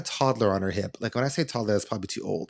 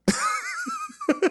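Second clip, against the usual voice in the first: high laugh. Real, not acted. Laughing at her own cheeky upgrade request, high squeal.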